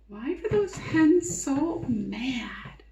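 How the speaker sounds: slow unvoiced whisper. Real, not acted. Whispered question to kids.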